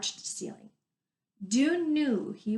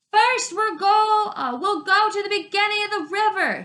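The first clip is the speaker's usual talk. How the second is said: shouting over the river; 11 semitones higher, 7 dB louder than usual. Acted. Arlen shouting into Dune's ear by the river, loud call. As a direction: yell it so he hears you over the river. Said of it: "shouted into his ear".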